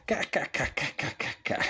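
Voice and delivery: staccato mock laugh in separate "ka" bursts; staged cackle. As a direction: fake a laugh, one sharp syllable at a time. Risada encenada após a fala. (risada encenada, cá-cá-cá)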